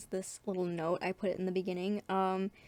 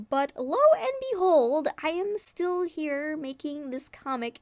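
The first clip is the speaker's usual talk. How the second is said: lightly hammed-up grand reveal, higher and louder; a touch of theatrical triumph; mock dramatic; 7 semitones higher, 6 dB louder than usual. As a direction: announce it like a lightly dramatic twist.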